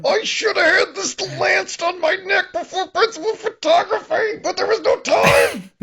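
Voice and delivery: mock crying. Joking cohost plays the crying Steven, hammed-up sobbing. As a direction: wail it like a fake sob.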